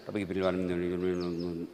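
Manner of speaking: low, slow, deliberate words; careful recitation. Shown as a demonstration. Preacher showing the proper unhurried prayer. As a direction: say each word slowly and low. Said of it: "Coba kan kita bicara".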